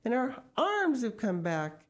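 laughed, joyful. A narrator's reading. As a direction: announce it with delight. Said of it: "laughed Missus Gregg".

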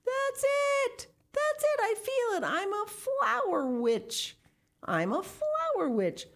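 very high, thrilled exclaiming; elated discovery. A narrator's reading. Miss Pinchin discovers she's a flower witch, elated. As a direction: burst out high with joyful realization.

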